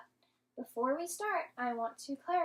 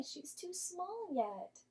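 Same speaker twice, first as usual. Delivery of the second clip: slow, soft and higher; tender; 7 semitones higher, 5 dB quieter, slower than usual. Real, not acted. Explaining baby bat's weakness.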